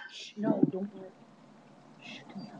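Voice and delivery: soft shushing whisper; hushing the dog. Real, not acted. Owner hushing her barking dog, whispered. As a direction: hush the dog so it stops barking.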